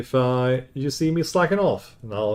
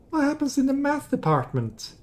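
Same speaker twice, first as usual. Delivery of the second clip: curious high question; 13 semitones higher than usual. Acted. Curious question then ominous answer.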